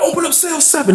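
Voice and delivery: high-pitched calling order; acted officer's call.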